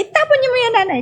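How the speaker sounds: high, sharp scolding from the doctor; angry doctor. Retold or imitated. Angry doctor scolds the mother over the medicine. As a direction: order her to throw it away. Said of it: "Galit na galit sa akin".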